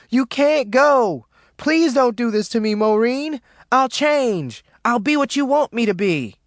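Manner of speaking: loud, high wailing plea; desperate pleading. A narrator's reading. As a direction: beg at full voice as she leaves. Ruby runs beside car, begging.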